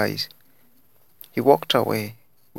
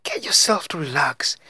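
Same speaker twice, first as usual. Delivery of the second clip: high-pitched, snapped order; 7 semitones higher than usual. A narrator's reading. Daisy telling Laura to relax, snapping. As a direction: snap at a friend in a raised pitch. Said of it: "she snapped".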